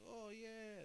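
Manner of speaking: high, excited crowd cheer; mock cheer. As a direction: cheer like a fan in the stands. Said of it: "cheering, like".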